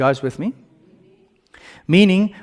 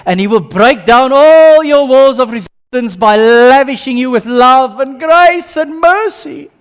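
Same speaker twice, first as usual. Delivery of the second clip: rising preaching; 9 semitones higher, 9 dB louder than usual. Acted. Preaching climax.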